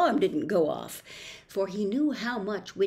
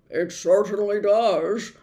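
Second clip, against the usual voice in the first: louder dog voice, matter-of-fact; watchdog Tock; 7 dB louder than usual. A narrator's reading. Tock agreeing with Milo, louder dog voice. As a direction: answer like a sturdy dog. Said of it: "the dog replied".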